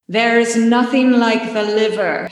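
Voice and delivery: spooky echo on every word; echoey ghost voice. Acted. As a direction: sound like a ghost in a hall. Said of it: "put a little echoey effect so it sounds like a spooky ghost".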